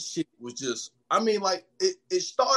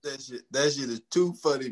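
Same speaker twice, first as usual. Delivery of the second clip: normal talking voice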